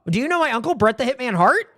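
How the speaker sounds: high, singsong name-dropping voice; Natalya. Acted. Wrestler asking about a famous uncle, high mock-sweet voice. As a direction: ask it in a high, hammed-up impression. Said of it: "Natalya is just like".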